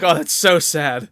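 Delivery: slow, high, loud mock lament; hammed-up sorrow; mock sad wail. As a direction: draw it out like fake tears. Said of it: "gonna start weeping".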